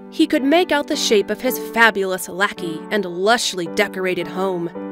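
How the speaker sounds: normal talking voice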